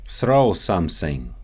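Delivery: Russian accent, "Throw something" said as "srow somesing"; Russian accent, S for each TH. Shown as a demonstration. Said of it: "The T H sound".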